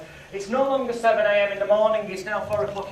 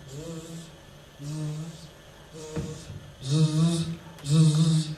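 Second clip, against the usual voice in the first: fly buzzing; 4 semitones lower, much slower than usual. Retold or imitated. Imitating flies buzzing.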